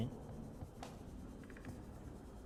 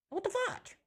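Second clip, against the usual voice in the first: much higher, shocked, stress on fudge; shocked squeal; slower than usual. Real, not acted. Repeats louder in shock.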